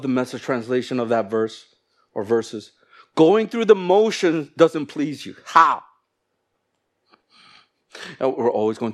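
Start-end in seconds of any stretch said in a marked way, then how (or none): 3.1-5.8 slow, loud, high proclamation; forceful scripture reading. Real, not acted. Preacher reading the Message translation to church, forceful proclamation. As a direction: proclaim the verse slowly and loudly.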